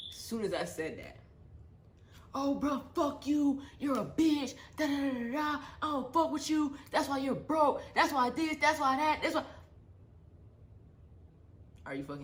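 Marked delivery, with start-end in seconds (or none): 2.3-9.4 high-pitched, loud mocking curse; imitating the sister cursing her out, high; mock sister tirade. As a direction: mimic her spitting insults.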